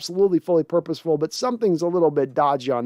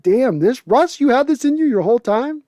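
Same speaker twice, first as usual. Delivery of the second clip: amazed disbelief; 7 semitones higher, 3 dB louder than usual. Retold or imitated. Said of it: "Bobby'd be like, eyes like saucers".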